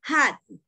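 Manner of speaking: "Hot" drilled for the class; model pronunciation of hot. Shown as a demonstration. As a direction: repeat "Hot" for students to copy. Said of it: "la pronunciación es hot".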